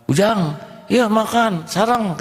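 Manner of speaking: put-on high, kindly old grandfather; a high, lightly voiced kindly old man; old man offering food. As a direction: invite the boy to eat.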